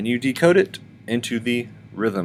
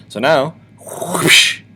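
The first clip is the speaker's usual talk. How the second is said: whoosh; 5 semitones higher, 7 dB louder, much slower than usual. Shown as a demonstration. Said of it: "whoosh sound effect".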